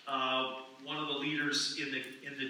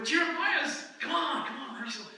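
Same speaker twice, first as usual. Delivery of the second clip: coaxing host; 11 semitones higher than usual. Retold or imitated. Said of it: "I felt a little pressure".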